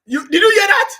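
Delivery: loud, high call to the viewers; did you hear that, cried out loud and high; incredulous shout. Real, not acted. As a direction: shout out in mocking disbelief. Calls viewers in disbelief.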